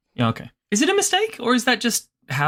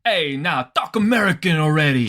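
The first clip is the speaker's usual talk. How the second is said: higher-pitched New York Italian voice; New York Italian accent; 4 semitones higher than usual. Acted. New York Italian telling someone off, New York Italian accent. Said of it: "a New York Italian would be like".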